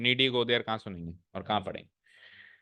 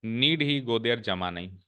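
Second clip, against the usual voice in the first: 1 slow read-out; much slower than usual. Shown as a demonstration. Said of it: "teacher reads the phrase slowly".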